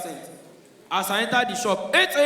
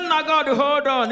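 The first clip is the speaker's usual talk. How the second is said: higher, louder, slow thrilled aside; excited; 6 semitones higher, 5 dB louder, slower than usual. Pretend. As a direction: hold God on the line, thrilled. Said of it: "He said".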